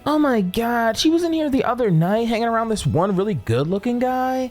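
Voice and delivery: lightly voiced chatty server; gossipy server. Server telling them about a good looking guy, lightly put-on gossipy voice.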